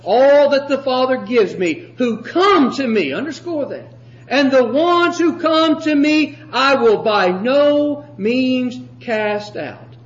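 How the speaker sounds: forceful preacher reading a verse aloud; emphatic pulpit reading with drawn-out ending; scripture declamation. Acted. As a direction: proclaim the verse, leaning hard on key words.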